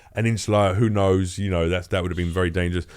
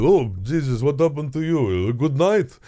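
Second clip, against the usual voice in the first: club owner voice; 2 semitones higher, slower than usual. Retold or imitated. Club owner voiced on seeing injuries.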